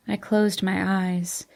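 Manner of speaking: usual speaking voice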